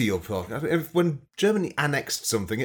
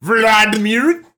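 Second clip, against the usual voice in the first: put-on Russian accent; 6 semitones higher, 7 dB louder than usual. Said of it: "just say his name in a Russian accent".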